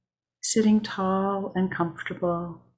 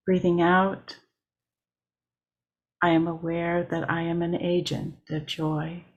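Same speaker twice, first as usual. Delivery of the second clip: measured meditation read with long pauses between lines; steady mindful guiding voice, line by line; guided meditation. Acted. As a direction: lead the breathing lines unhurried, letting each settle. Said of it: "I will begin with a guided meditation".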